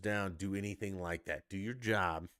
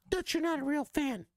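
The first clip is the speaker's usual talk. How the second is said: shrill high mock heckler voice, loud; mocking critic; 7 dB louder than usual. Mocking a viewer who calls him fake, squeaky heckler voice. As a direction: parrot a commenter in a squeaky put-on voice. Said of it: "embracing my labels".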